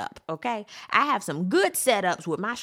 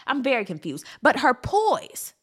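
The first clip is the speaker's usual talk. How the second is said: ordinary delivery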